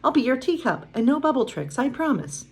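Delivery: earnest promise in a small high voice; serious little Chip. A narrator's reading. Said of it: "he added seriously".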